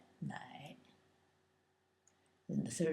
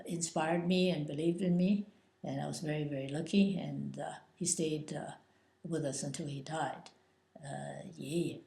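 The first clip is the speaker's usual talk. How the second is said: normal talking voice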